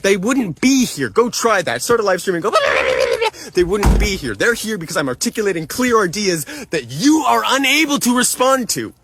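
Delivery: real exasperated outrage; heated rebuttal. Real, not acted. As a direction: argue back hard, punching the key words. Furious rebuttal continues.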